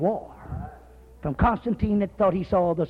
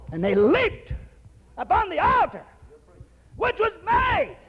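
preaching at full force; 10 semitones higher than usual. Acted.

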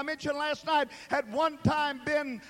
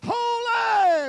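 high, loud, drawn-out holy; exalted cry; 9 semitones higher, 8 dB louder than usual. Acted. Seraphim crying out before the throne, high and loud. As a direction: cry each holy out like an angel.

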